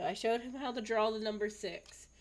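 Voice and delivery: ordinary talk, nothing marked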